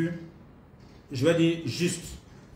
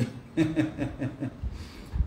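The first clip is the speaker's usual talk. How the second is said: chuckle; 5 dB quieter than usual. Real, not acted. Host reading participants' messages aloud, amused chuckling. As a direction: chuckle quietly to yourself.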